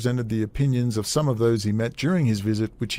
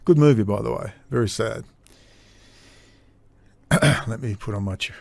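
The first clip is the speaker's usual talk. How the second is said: ordinary talk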